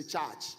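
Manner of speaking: "church" as said in Kenya, with an open a-like vowel; Kenyan-style "church"; Kenyan pronunciation. Shown as a demonstration. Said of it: "You go to Kenya, they say".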